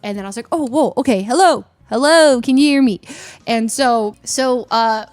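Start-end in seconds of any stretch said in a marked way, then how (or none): 0.5-3.0 louder mic check, hello hello; calling out. Retold or imitated. Player checking if judges hear her, calling out. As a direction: test the mic, raising the voice.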